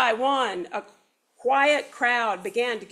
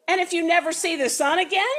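snarled; 6 semitones higher, much faster than usual. Acted. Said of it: "snarled the mayor".

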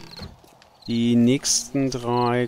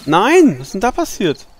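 high, loud cry of dismay; shocked shout; 9 semitones higher, 8 dB louder than usual. Real, not acted. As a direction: yell it out in disbelief. Trap breaks unexpectedly.

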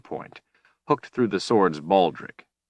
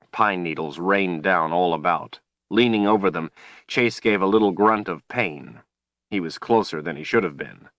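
usual speaking voice

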